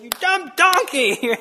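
slow, high-pitched insult; mock angry. Balaam falling down and cursing his donkey, mock anger. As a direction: draw out the exasperated scolding. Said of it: "so Balaam's all mad".

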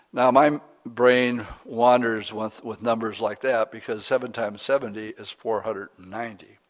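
ordinary talk, nothing marked

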